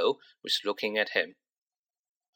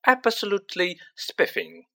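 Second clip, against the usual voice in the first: higher, hammed-up toff voice; mock-posh gush; 8 semitones higher than usual. A narrator's reading. George teasing Percy, hammed-up posh gush. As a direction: overdo the upper-class delight.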